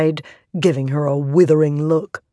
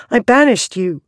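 louder insistent denial; 6 semitones higher, 4 dB louder than usual. A narrator's reading. Shallan repeats the denial louder.

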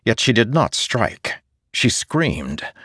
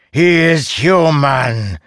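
high, loud, slow shout back; 7 semitones higher, 4 dB louder, slower than usual. Acted. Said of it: "she shouted back".

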